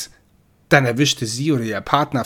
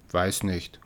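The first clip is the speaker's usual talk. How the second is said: low, quieter mock growl; 5 semitones lower, 5 dB quieter than usual. Cat answers Death grudgingly, soft growl. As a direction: grumble it like a sulky cat. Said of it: "knurrte Mri".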